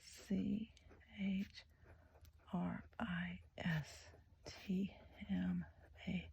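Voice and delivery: soft, slow letter-by-letter spelling; spelling to herself. Real, not acted. Crafter spelling out a word as she writes it, quietly to herself. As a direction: quietly spell it out while writing.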